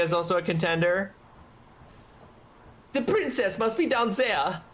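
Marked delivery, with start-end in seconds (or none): 2.9-4.6 put-on high German-accented knight; German-accented captain. Captain tracking the princess down, German accent. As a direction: announce it in a pitched-up German accent. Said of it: "that was a German accent".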